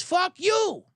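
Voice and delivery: high-pitched mock curse; retold angry retort. Driver curses the man he almost hit, mock angry. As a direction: throw it back as the offended driver. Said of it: "Then he goes".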